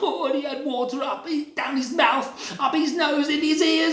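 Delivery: high, frantic splutter; comic floundering. A narrator's reading. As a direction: narrate it while thrashing and gurgling in water. Acting out bunny floundering in water.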